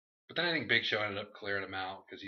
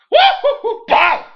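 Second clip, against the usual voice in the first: very high, loud laughing whoop, then a pow; imitated firework burst; mock whoop; 18 dB louder, slower than usual. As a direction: whoop and boom like entrance pyro.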